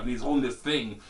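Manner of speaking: ordinary talk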